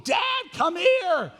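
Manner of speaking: put-on high, drawn-out call of a child from the other room. Four-year-old calling his dad from another room, high and loud. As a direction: call Dad from the back bedroom, high and slow.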